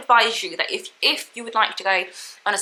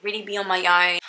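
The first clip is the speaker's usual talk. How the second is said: normal talking voice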